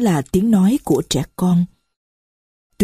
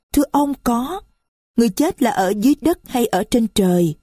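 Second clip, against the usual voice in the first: put-on higher, small voice of a young girl; little girl's voice; 5 semitones higher than usual. Little Marie complains to the stranger, high child voice. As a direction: speak as a little child who is hurt.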